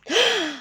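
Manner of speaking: a sharp, high surprised gasp. Acted. Helpers recognising Jesus, a sharp gasp. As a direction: catch your breath in shock. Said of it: "the helpers gasped".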